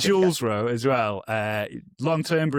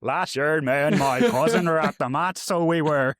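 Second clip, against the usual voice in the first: put-on lightly louder broad Belfast accent; 2 semitones higher, 5 dB louder than usual. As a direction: read it in a Northern Irish Belfast accent. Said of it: "Can you, can you do a Belfast accent?".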